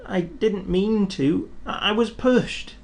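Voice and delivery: a little engine owning up, a touch sad. A narrator's reading. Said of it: "said Thomas sadly".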